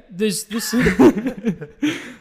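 laughing. Real, not acted. Laughing at website news.

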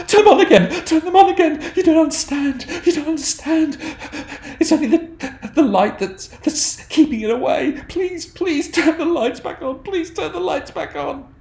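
high, loud panicked begging; terrified screaming plea. Acted. Game master voicing Lindsay, screaming in terror for the lights. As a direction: scream for the lights to come back on. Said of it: "she's wailing and screaming and crying".

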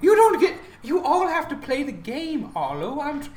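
high theatrical protest, sinking on the last word; pleading game host. Acted. Ancient host asking a player for fun, hammed-up pleading.

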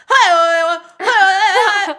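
loud, high mock yelling; imitated screaming. Diner imitating the screaming at a tuna cutting competition, mock screaming. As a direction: hammer out a wordless mock scream. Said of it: "like, just screaming".

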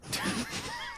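high squealing laugh; shrieking laugh. Real, not acted.

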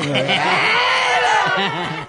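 high shrieking laugh. Real, not acted. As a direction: burst out in a squeal of laughter. Laughing at own joke. (ngguyu cekakakan nyaring)

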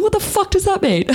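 high squeal of disbelief, half laughing; incredulous. Retold or imitated. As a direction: replay your bafflement way up high, amused. Baffled reply to mum, retold.

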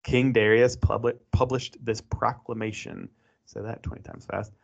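normal talking voice

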